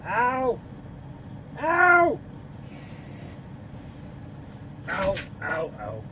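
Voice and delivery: sharp high ows from a burn; pained yelps. Real, not acted. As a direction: yelp like a scalded tongue. Burned by hot soup.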